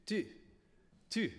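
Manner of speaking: "tu" with rounded, pushed-forward lips; French tu. Shown as a demonstration. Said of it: "big difference between toute and tu".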